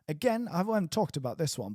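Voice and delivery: normal talking voice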